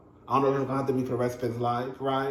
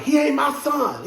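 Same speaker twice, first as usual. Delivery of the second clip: quoting Todd; 9 semitones higher than usual. Retold or imitated. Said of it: "Todd was like".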